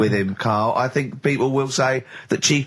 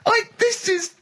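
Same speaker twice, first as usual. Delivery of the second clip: laughing squeak. Real, not acted. Laughing in disbelief.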